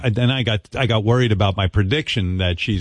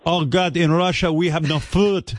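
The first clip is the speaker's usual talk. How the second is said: higher, pleading mock Russian accent; mock Russian bride; 6 semitones higher than usual. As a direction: ham up a begging Russian bride in a fake accent. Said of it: "Even mail order brides know better".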